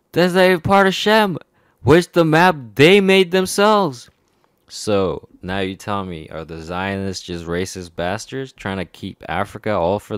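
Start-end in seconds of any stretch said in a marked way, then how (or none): 0.1-4.1 loud, very high indignant outburst; real outrage. Real, not acted. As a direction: let the exasperation burst out, high and loud. Worked-up rant about the map.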